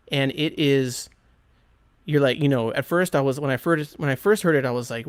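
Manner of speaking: ordinary talk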